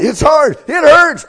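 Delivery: very high, loud pained cries; runner in pain. Acted. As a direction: cry out in pain. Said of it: "as that runner gets tired".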